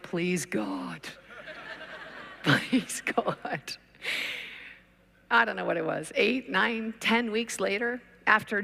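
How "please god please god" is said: weary prayer. Retold or imitated. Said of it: "I was like".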